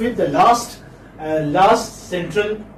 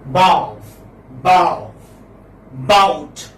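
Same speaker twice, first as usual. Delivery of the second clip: "Bow, bow, bout" each hit firmly; lower-pitched, forceful accent on "Bow, bow, bout"; stressed syllable; 4 semitones lower, much slower than usual. Shown as a demonstration. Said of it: "with a stress, with an accent".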